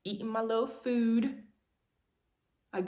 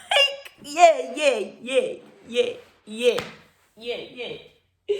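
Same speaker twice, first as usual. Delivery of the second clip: loud half-laughing, half-crying yeahs, fading out; laughing through tears; 3 semitones higher, 5 dB louder, slower than usual. Retold or imitated. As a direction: giggle and sob the yeahs together, then trail off. Laugh-cry after result.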